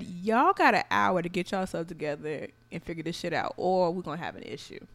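low, firm reenacted warning; retold ultimatum. Laying down ultimatum to roommate.

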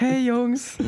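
high, chirpy hostess call; high sweet greeting voice. Retold or imitated. Imitating a woman with iced tea.

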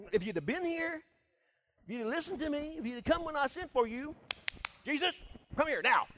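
preacher acts out Martha demanding from Jesus. Retold or imitated. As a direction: order him to come.

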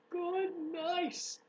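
loud high scream. Acted. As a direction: scream it in disgust. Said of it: "screamed Avery".

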